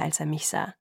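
ordinary delivery, nothing marked

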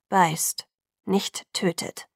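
"Beißt" pressed hard, then a slow, deliberate "nicht tötet"; stressed contrast. A narrator's reading. Jacob spelling out the treaty to Bella, stressing "Beißt". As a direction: lean on "Beißt", set it against "tötet". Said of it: "betonte er".

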